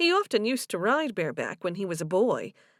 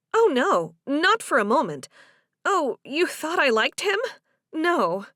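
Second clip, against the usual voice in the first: higher, louder woman's voice in quick denial; startled Delia; 6 semitones higher, 5 dB louder than usual. Acted. Delia denies to Joe that she liked his cousin, startled. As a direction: play her aghast at the mistake.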